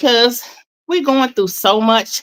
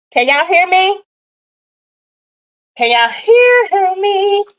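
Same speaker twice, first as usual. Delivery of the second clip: loud, high call to viewers; 10 semitones higher, 6 dB louder than usual. Real, not acted. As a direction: call out to the audience. Calling out to viewers.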